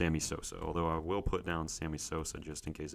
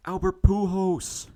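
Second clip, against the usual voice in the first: much higher, louder, slow hopeful call of the name; drawn-out excited guess; 13 semitones higher, 8 dB louder, slower than usual. Real, not acted. Player guessing a star name in a quiz, slow excited call-out. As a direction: stretch the name out like an announcer calling a star.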